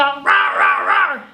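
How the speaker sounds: a light mock roar; playful tiger roar. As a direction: give a quick cartoon roar. Tigers roaring in song.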